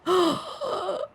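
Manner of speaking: soft, high mock gasp in awe; imitated gasp. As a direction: mimic the character's amazed gasp. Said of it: "Maresa's just like".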